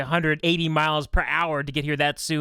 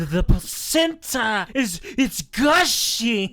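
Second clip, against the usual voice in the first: slow mock voice; 3 semitones higher, much slower than usual.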